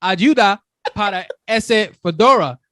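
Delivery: put-on Spanish in an American accent; halting American accent Spanish; gringo Spanish. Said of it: "I sound like a gringo".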